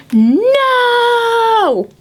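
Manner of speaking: put-on high, loud toddler no; mock toddler shout; defiant shriek. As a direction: yell it like a tantrum. Child refuses again.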